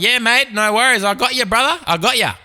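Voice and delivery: high-pitched, chummy reassurance; eager mate. Acted. Answering the zombie who called him living, mock eager. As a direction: play an over-keen helper, pitch up. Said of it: "I'd be like".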